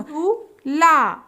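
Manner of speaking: झूला stretched very slowly; slow drawn-out word. Shown as a demonstration. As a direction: draw out झूला syllable by syllable. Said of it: "तीन वर्ण वाले शब्द".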